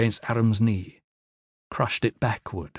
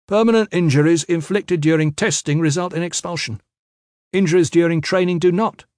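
cool, mechanical; 7 semitones higher, 4 dB louder than usual. A narrator's reading. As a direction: rule recited in a raised, higher voice. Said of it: "Cruxer's voice was cool, mechanical".

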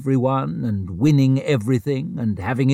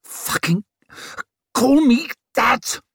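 breathless furious snarl; 11 semitones higher than usual. A narrator's reading. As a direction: snarl it in fury, gulping for air. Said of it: "she snarled over her shoulder between whooping breaths".

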